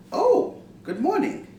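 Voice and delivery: high, bright "Oh, good morning"; pleasantly surprised morning greeting; delighted greeting. Shown as a demonstration. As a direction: sound thrilled to be greeted. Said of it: "more of an expression".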